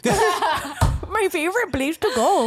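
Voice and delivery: a high, laughing put-on Irish accent; mock Irish accent. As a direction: gush about Dublin in a hammed-up Irish lilt. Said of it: "Dublin Comedy Festival".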